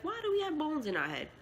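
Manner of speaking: a high, lightly voiced teacher asking back; mother voicing the teacher asking her son, high and questioning; imitating the teacher. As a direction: echo the question the way a patient teacher would. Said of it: "the teacher asked him".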